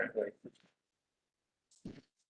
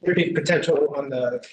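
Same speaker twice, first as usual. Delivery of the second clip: louder for a listener who can't hear; speaking up; 3 semitones higher, 8 dB louder than usual. Real, not acted. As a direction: raise the volume so they hear. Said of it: "Speak up, please".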